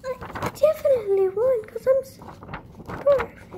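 high-pitched mock character voice; play-acted squeaky little voice; put-on high voice. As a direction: pitch it right up, as if play-acting. Puts on high voice for boasting.

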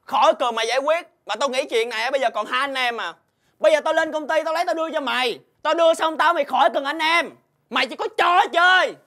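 angry, hurt outburst. Acted. Debtor breaking off the friendship over a debt, angry. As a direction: escalate to a high, angry shout by the end. Quarrel peaks, debtor rejects the mediator.

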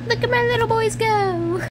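high, loud, gushing; excited squeal. Real, not acted. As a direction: coo at the candies like pets. Watching grapes in microwave.

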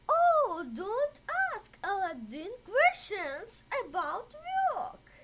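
a fussing mother cutting in; mother's voice. A narrator's reading.

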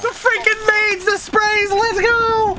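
loud, high celebratory yelling; victory shout. Real, not acted. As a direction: whoop it up after winning. Victory celebration after win.

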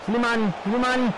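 high, thrilled goal shout; excited goal call. Real, not acted. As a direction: cheer the goal.

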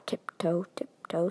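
hushed, creeping whisper; tiptoe whisper. Acted. Dreamer creeping towards the light, whispering. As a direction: whisper it softly, as if sneaking.

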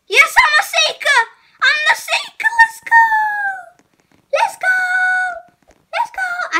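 high, loud thrilled cheer; excited cheering. Real, not acted. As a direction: celebrate at the top of your voice. Chosen as seeker, celebrating.

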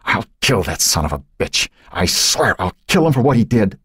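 seething threat, rising in pitch; acted father's rage; enraged vow. A narrator's reading. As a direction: swear vengeance, rising as it goes. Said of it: "his eyes glittering with rage".

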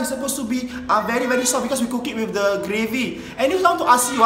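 ordinary talk

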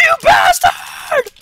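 very high, drawn-out, loud howl; outraged shout. Real, not acted. Player about to die cursing the game's enemy, outraged shout. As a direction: howl it in outrage, stretching the words.

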